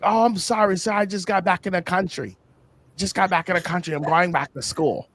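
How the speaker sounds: high, put-on English apology; Englishman accent. Driver excuses expired papers to the cop in a put-on English accent. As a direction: plead innocence in a high mock-English voice. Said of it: "hits this Englishman accent".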